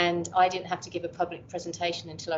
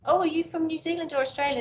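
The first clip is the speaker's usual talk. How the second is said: higher-pitched, quicker mock questioner; curious stranger; 10 semitones higher, faster than usual. People guessing the speaker's accent, imitated curious stranger. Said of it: "some people go".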